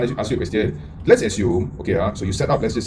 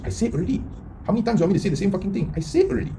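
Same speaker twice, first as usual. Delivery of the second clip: higher, fast, fed-up repeating; sick of saying it again, spit it out faster; exasperated rant; 6 semitones higher, 3 dB quieter, faster than usual. Real, not acted. To viewers who never listen, exasperated and much higher.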